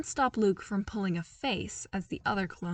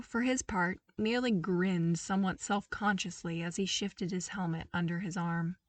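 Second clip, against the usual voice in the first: ordinary delivery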